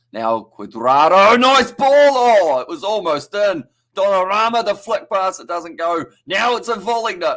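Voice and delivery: loud, high, thrilled goal-chance call; excited commentary. Real, not acted. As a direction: shout it as the ball nearly goes in. Near goal in live commentary.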